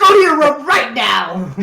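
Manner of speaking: loud, high mock parent order. Friend joking as a scolding parent, mock parent order. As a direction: send the kid off like an angry parent. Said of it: "playing the parent".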